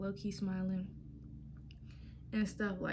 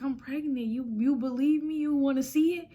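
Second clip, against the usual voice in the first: urgent, rising pitch; 5 semitones higher, 7 dB louder than usual. Retold or imitated. Pleading with boyfriend on phone.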